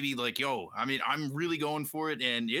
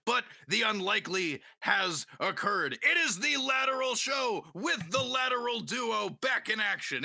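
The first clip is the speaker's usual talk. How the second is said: hype announcer; 6 semitones higher than usual. Acted. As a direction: build it up like a big reveal. Hyped show opening.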